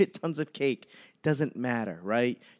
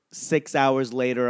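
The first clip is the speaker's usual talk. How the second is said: normal talking voice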